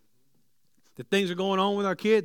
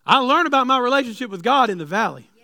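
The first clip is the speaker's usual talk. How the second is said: loud, high preaching climb; preaching at full force; 6 semitones higher, 6 dB louder than usual. Acted. Preacher loudly telling the church life is not perfect. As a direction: press it hard on the church.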